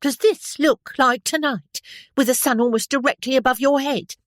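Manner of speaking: high, loud scolding washerwoman. A narrator's reading. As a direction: tell off the officer, stick waving. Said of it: "could not imitate her broad Cockney accent".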